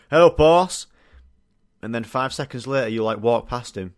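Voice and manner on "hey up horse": loud Yorkshire "Hey up, horse" greeting; Yorkshire accent. Retold or imitated. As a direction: bellow it like a Yorkshire lad. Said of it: "horse in the Yorkshire accent".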